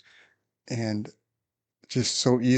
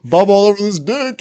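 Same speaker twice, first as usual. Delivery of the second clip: much higher, louder puzzled read-out; mock confused reading; 11 semitones higher, 10 dB louder than usual. Officer reads the roster aloud, loud and puzzled. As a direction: read the fake name aloud, baffled and loud. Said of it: "And he's like".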